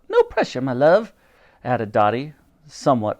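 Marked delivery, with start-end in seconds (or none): 0.1-1.1 high-starting motherly character line; Dottie's character voice. A narrator's reading. Dottie adds unhelpful comfort to Titch, high character voice. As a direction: add it in a raised character voice.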